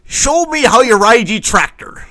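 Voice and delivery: high, loud hammy country lover; mock farmer seduction. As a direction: yell it like a lusty farmer. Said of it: "he started off like a farmer".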